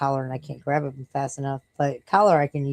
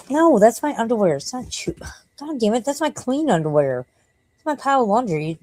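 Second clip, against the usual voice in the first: scolding the dog; 6 semitones higher than usual. Real, not acted. Scolding dog over underwear.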